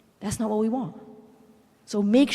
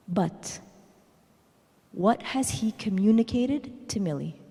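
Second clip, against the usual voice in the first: ordinary talk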